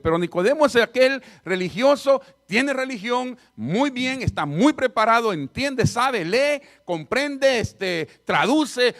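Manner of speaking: a preacher stacking up praise before the turn; fiery preaching. Real, not acted. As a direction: preach it, rising with each word. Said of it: "preaching at full force".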